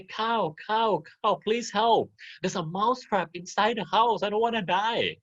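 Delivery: high, pleading little mouse voice; begging mouse. Acted. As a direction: beg for help like a tiny frightened mouse. Mouse begs the cow.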